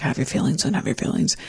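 soft whisper of "Have your feelings"; hushed inner permission; whispered self-talk. Retold or imitated. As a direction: breathe the words to yourself, no voice. Inner voice allowing her feelings.

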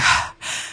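heavy puffed-out breath; acted exhausted sigh. Collapsed skier leaning over to rest, acted sigh. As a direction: let out a spent, breathy sigh. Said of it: "and I was like".

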